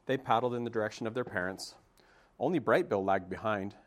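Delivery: ordinary delivery, nothing marked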